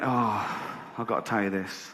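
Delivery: a hint of a boy's eager confession; hushed confiding. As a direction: lean in and drop the voice.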